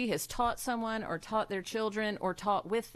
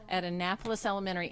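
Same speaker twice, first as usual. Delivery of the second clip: ordinary delivery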